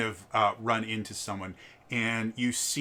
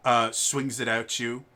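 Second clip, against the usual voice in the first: ordinary talk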